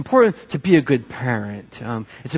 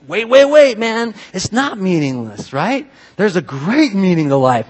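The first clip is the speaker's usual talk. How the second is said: higher-pitched protest, drawn out at first; urgent objection; 5 semitones higher, 5 dB louder than usual. Acted. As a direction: cut in like a believer who must object. Believers objecting to meaninglessness.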